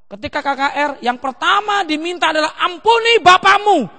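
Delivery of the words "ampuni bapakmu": loud, high, drawn-out plea; imitated altar call, pitched up; revival appeal. Retold KKR altar appeal to children.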